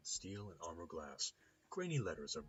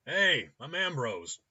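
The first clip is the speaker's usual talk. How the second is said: slow, high, booming greeting; cheerful shout; 9 semitones higher, 15 dB louder, slower than usual. A narrator's reading. Hungover clinic owner introducing himself, shouting cheerfully. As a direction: shout a big hearty hello. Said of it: "He shouts cheerfully in English".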